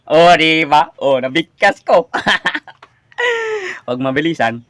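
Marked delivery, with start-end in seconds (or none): 3.2-3.8 short high giggle; high laugh. Real, not acted. Vlogger giggles high after getting the word out. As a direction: giggle at the small win.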